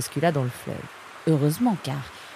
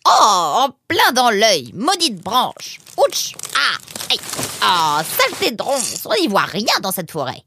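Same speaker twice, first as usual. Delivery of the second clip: pained yelps and louder, higher griping; grumbling and cursing; 5 semitones higher, 5 dB louder than usual. Acted. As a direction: curse your way painfully through brambles. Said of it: "Anémone pestait et avançait avec peine".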